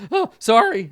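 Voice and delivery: high, loud little apology; flustered wizard. Acted. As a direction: yelp it out when the arm drops. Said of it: "He's like".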